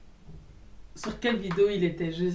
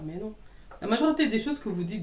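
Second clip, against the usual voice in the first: ordinary delivery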